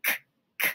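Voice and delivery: short unvoiced "k" bursts; sounding out C for camel; hard k sound. Shown as a demonstration. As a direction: model the k sound. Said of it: "That's the sound that".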